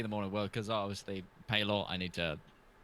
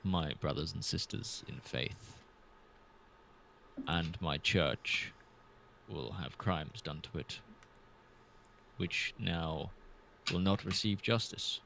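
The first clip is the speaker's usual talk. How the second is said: low, slow solemn confession with heavy pauses; measured, penitent in-character speech; solemn player character; 6 semitones lower than usual. Acted. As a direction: speak gravely and haltingly, low in pitch.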